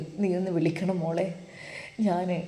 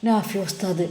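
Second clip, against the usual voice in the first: plain reading voice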